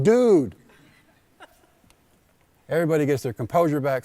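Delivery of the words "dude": loud, high exasperated "Dude."; a loud, high, exasperated cry of "Dude."; exasperated shout. Retold or imitated. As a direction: blurt it out loud and high at the umpire. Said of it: "I'm like".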